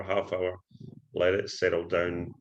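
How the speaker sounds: usual speaking voice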